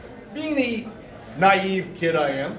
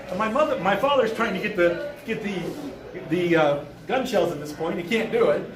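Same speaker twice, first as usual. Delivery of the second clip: plain reading voice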